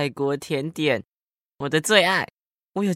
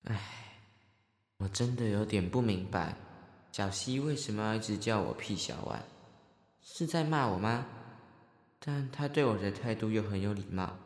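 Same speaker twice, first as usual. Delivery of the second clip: much softer puzzled aside; inner thoughts; 5 semitones lower, 12 dB quieter than usual. Acted. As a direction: mutter to yourself, puzzled. Inner monologue muttered aside.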